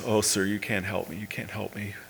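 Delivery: mild retold hopelessness, dropping away; softly imitated distress. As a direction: voice a weeping girl quietly, without sobs. Weeping girl answers the preacher.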